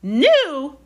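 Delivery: high, loud, scornful shriek; piercingly high outburst; sudden shriek. A narrator's reading. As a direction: shriek it back at the boy. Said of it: "shrieked Aunt Petunia suddenly".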